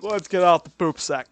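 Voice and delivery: loud, put-on cartoon voice; mock impression voice. Fan imitating Peter's voice, hammed-up and loud. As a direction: ham it up like a fan doing the voice. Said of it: "people would be like".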